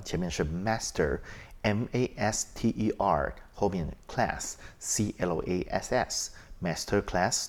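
slow letter-by-letter spelling, M A S T E R. Shown as a demonstration. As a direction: spell each letter slowly and clearly. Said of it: "Masterclass怎么拼呢？".

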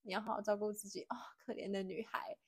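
soft, fading to a breathy whisper; lightly voiced old grandma, gentle and hushed; grandma's comfort. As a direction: hush it like a grandma soothing a crying girl. Grandma comforting, quoted in story.

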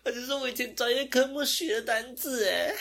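high, wailing fake sob over a forgotten word; hammed-up despair, voice breaking into a sob with some laughter; mock crying whine. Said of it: "我就说".

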